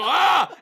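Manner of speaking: high, loud comic alarmed yell; hammed-up panicked shriek; mock scream. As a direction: soldiers freaking out in parliament, loud mock scream. Soldiers freak out.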